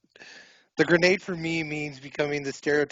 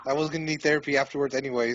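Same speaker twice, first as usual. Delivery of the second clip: ordinary talk, nothing marked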